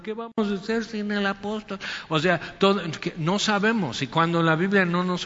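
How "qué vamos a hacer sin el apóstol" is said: mock crying. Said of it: "y Timoteo llorando".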